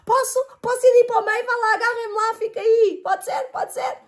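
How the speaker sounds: high pleading voice; high childlike plea; pleading little fish. A narrator's reading. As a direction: beg sweetly to sit in the middle.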